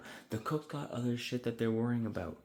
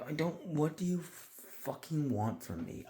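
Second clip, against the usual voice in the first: higher, slow, drawn-out mock frustration; exasperated attitude; 7 semitones higher, slower than usual. Staffer giving attitude over missing cheese, exasperated attitude. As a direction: act out fed-up attitude, dragging the words. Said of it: "just attitude of just like".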